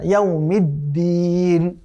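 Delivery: ইয়াউমিদ্দিন slow with a light, thin দাল; ইয়াউমিদ্দিন with a light দাল, said slowly; correct pronunciation. Shown as a demonstration. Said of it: "দাল এটি পাতলা হবে".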